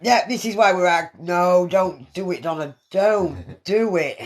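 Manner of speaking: pleading shout. Real, not acted. Urging friend not to drink.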